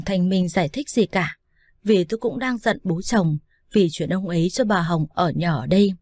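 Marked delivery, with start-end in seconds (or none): none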